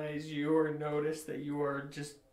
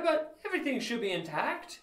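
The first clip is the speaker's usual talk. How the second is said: normal talking voice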